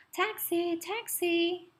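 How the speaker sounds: high, loud call across the street; man calling out loudly to hail a taxi; shouting for a taxi. A narrator's reading. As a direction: hail a cab loudly, twice. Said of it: "shouts Mister Tripp".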